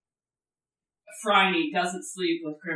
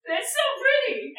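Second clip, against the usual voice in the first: delighted; slower than usual. Retold or imitated. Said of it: "I was like".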